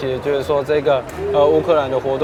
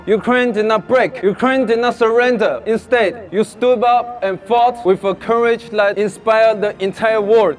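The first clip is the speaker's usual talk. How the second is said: measured speech from the podium; formal speech; 6 semitones higher than usual. Acted. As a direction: read it like an address to a crowd. Delivers a rally speech.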